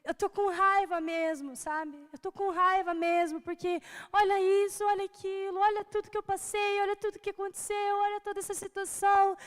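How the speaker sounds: retold tearful outburst. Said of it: "comecei a chorar e dizer".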